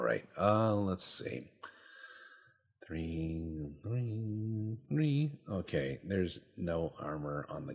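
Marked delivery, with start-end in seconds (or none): 2.9-5.3 rising counted threes. Real, not acted. Reading out armor values.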